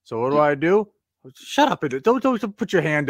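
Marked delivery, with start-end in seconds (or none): 1.3-3.1 high, loud mock outrage; mock indignant. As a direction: playfully snap at your co-host. Playfully silencing co-host mid-story.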